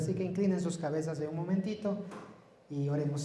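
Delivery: ordinary talk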